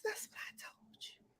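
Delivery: whispered aside; barely audible, under the breath; muttered retort. Retold or imitated. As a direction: whisper it as a private comeback.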